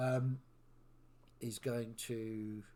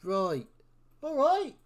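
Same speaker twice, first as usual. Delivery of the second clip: loud, high in-character answer; shouted reply; 11 dB louder, slower than usual. Acted. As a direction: yell agreement back, jumping up in pitch.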